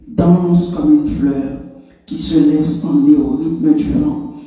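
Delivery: forceful, incantatory poetry reading in short phrases; punched-out refrains, insistent and rhythmic; emphatic poem recitation. Acted. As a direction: declaim it like a chant, pausing between phrases. Recites own poem aloud.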